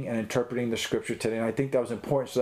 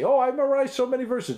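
high-pitched, showy mock brag; mock boasting; 12 semitones higher, 3 dB louder than usual. Teacher voicing someone memorizing for a check mark, mock boastful. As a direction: brag in a much higher put-on voice.

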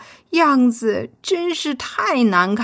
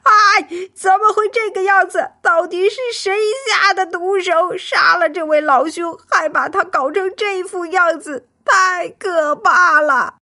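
terrified outcry; 7 semitones higher than usual. A narrator's reading. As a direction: cry out in shock at the gruesome sight. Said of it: "大金吓得失声大叫". (惊恐地失声大叫)

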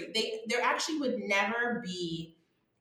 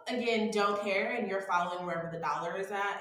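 normal talking voice

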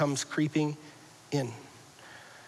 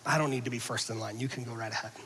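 much softer, lower and calm; gentle inner voice; 5 semitones lower, 9 dB quieter, faster than usual. Acted. God's voice guiding the listener, soft and calm. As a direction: speak it like a quiet conscience. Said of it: "external commanded voice that says".

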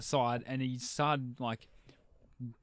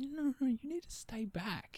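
high-pitched retold pleading; anxious warding off; 12 semitones higher, 4 dB quieter than usual. Door worker holding customers back, high retold urgency. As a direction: re-enact it high, without raising the volume.

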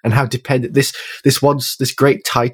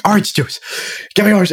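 desperate demand; 9 semitones higher than usual. Retold or imitated. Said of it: "he's like".

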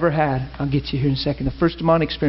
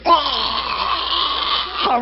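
high, sudden hammed-up shriek; the lady collapsing with a cry; 10 semitones higher than usual. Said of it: "this lady goes".

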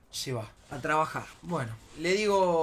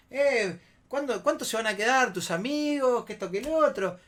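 raised, louder mocking quote of pestering women; goading, louder and higher; mimicked needling; 7 semitones higher, 4 dB louder than usual. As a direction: mimic them egging someone on. Mimics women goading his friend.

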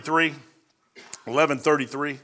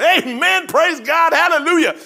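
high, loud exultant amen; joyful praise; 12 semitones higher, 6 dB louder, slower than usual. Acted. His spirit answering the book, in loud high joyful praise. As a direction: let the spirit cry out praise. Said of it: "my spirit is going".

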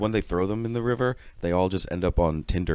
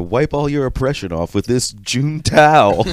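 mock ad pitch; 6 semitones higher, 12 dB louder, slower than usual.

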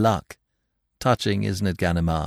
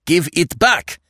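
higher, louder, sharp order; angry demand; 7 semitones higher, 4 dB louder than usual. A narrator's reading. Inspector losing patience with Lupin, louder angry demand. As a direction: insist now, temper rising.

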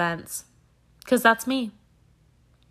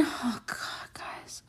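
hushed, voiceless whisper; whispered aside; 16 dB quieter, much slower than usual. Real, not acted. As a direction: whisper it like a private confession.

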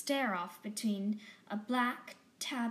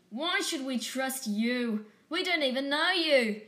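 proud tough alley cat, louder and higher at the end; defiant tom cat; 4 semitones higher, 7 dB louder than usual. A narrator's reading. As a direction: sneer at the stranger. Tabby tom challenges Robin.

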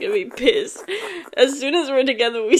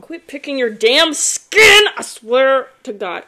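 scolding mom; 3 semitones higher, 7 dB louder, slower than usual. Retold or imitated.

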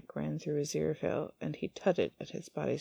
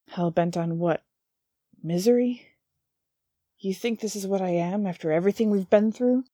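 angry, tinged with sadness; 3 semitones higher, 7 dB louder than usual. Acted. Demon hurt by the angel's accusation, loud wounded anger. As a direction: shout through the hurt. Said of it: "anger in Crowley's voice that was unmistakably tinged with sadness".

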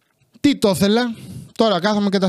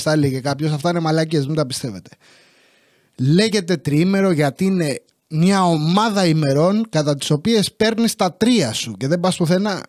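usual speaking voice

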